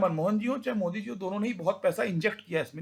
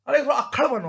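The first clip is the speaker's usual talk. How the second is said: village uncle; 5 semitones higher, 7 dB louder than usual. Retold or imitated. Uncle urging toughness, rustic speech.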